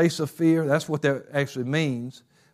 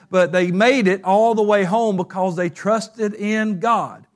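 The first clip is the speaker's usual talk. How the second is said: rising, ringing sermon voice, higher and louder; preaching at full force; 5 semitones higher, 4 dB louder than usual. Acted. Preacher rousing the church against fear, full force. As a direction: build the congregation up to full fervor.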